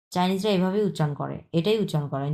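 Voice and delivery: usual speaking voice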